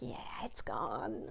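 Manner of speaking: soft breathy whisper. Retold or imitated. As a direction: hush it like a secret. Quoting email reply.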